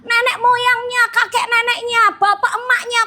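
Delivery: high, impassioned sermon delivery; fervent preaching. Acted. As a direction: preach it out with rising fervor. Preaching at full force.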